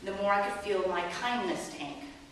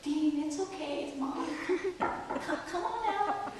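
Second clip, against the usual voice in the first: higher, gentle coaxing through a door; tender mom voice, a little higher; loving mom coaxing; 8 semitones higher, 4 dB quieter, slower than usual. Retold or imitated. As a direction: sweetly coax a child out. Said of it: "I'm totally loving".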